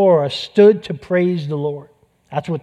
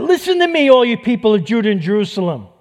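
higher and louder king's call to the people; fired-up king addressing his people; rallying cry; 5 semitones higher, 4 dB louder than usual. Acted. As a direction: rouse the troops, call out.

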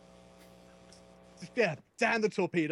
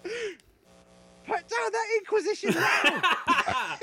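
stern mum voice; 5 dB louder, much slower than usual. Retold or imitated. Mum voice continues.

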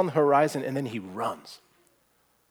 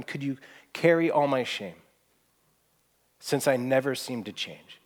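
usual speaking voice

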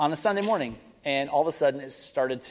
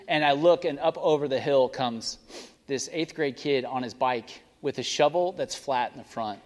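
ordinary talk, nothing marked

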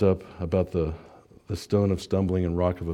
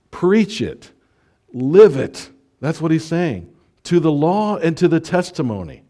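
high, loud, building exhortation; rising preacher's charge; fiery preaching; 5 semitones higher, 5 dB louder than usual. Real, not acted. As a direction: drive it home to the congregation. Preaching at full force.

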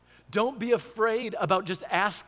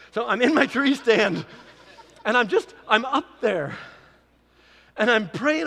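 laughing; 4 semitones higher, 9 dB louder than usual. Real, not acted. Laughing at himself, hunting tree story.

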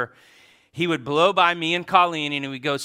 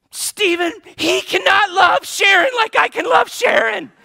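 put-on much higher, much louder wailing child protest; little boy's crying shout; 11 dB louder than usual. Small boy shouting at Stephen over the phone, much higher and louder wailing. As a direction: wail it like a heartbroken toddler. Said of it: "He starts crying".

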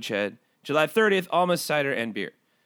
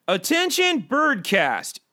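formal announcement; 10 semitones higher, much slower than usual. Shown as a demonstration. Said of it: "I will read it verbatim".

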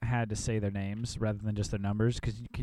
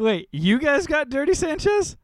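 high, loud burst of surprise; excited outburst; 14 semitones higher, 8 dB louder than usual. Real, not acted. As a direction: blurt it out in disbelief. Excited outburst at draft news.

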